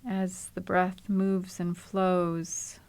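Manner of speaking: calm, unhurried body-scan voice with long pauses; even, settled meditation delivery, leaving space to breathe; guided meditation. Acted. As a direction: guide them gently into the body, phrase by phrase.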